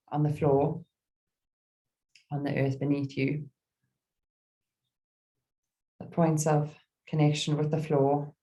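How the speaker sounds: calm meditation guide with long pauses; gentle, spacious mindfulness voice; guided meditation. Real, not acted. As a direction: ease listeners into stillness, phrase by phrase.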